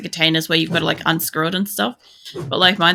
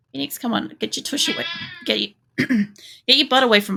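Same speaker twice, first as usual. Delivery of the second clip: coaxing a pet; 7 semitones higher, faster than usual. Real, not acted. Coaxing the cat off the mouse.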